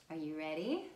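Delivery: usual speaking voice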